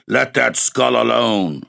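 slow, gruff stranger's voice. A narrator's reading. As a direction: drag it out roughly, menacing. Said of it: "said a gruff voice".

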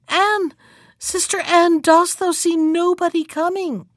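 high, urgent call; crying out. A narrator's reading. Wife to her sister on the tower, crying out. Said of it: "and then she cried out".

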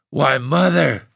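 high stunned gasp on Mother; breathless disbelief. A narrator's reading. Husband stunned by his wife's revolt, gasping. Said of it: "the old man gasped".

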